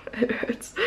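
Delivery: breathy whisper of real soreness; pained whisper. Real, not acted. Vlogger with sore arms, whispering in pain.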